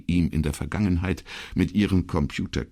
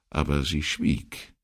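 usual speaking voice

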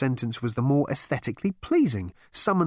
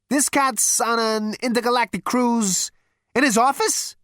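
baffled Zaphod; 11 semitones higher, 4 dB louder than usual. Acted. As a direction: work it out aloud.

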